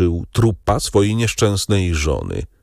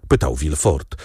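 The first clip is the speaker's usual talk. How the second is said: plain reading voice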